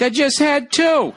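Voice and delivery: put-on high-pitched, drawn-out kid's excuse; whiny protest. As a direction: protest high, stretching the last word. Acts kid answering dad's accusation.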